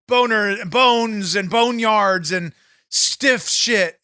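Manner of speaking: ordinary talk, nothing marked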